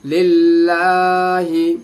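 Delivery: लिल्लाही stretched long and sung; drawn-out recitation. Shown as a demonstration. Teacher joining the letters into लिल्लाही, drawn out. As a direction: hold the vowels of लिल्लाही in recitation tone. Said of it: "उसको आप खींचेंगे थोड़ा सा".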